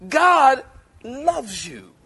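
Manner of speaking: slow, drawn-out loving assurance; slow earnest reassurance; tender emphasis. Real, not acted. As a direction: press love into each word. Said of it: "tender emphasis".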